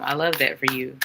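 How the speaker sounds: ordinary delivery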